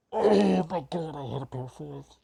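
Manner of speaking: real exasperated yell, high and very loud; anguished outburst. Real, not acted. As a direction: drag out a loud, high cry of dismay at your own blunder. Realizes own blunder in game.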